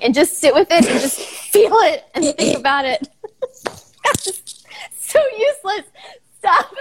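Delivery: losing it mid-instruction; can't keep a straight face; breaking character giggling. Real, not acted.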